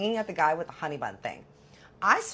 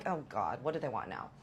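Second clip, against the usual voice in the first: muttered dread; 8 semitones lower, 9 dB quieter than usual. Retold or imitated. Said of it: "I'm like".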